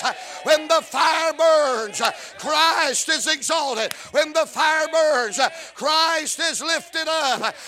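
high, sustained revival shout; full-force preaching. Real, not acted. Preacher rousing the church about the fire of God, full-force preaching. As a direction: preach it hard, stretching the big words.

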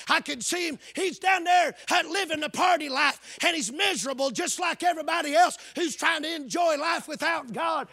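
loud, high preaching climax; full-force preaching. Acted. Preacher picturing the father waiting, shouting. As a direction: drive it home, shouting to the church.

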